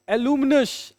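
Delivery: normal talking voice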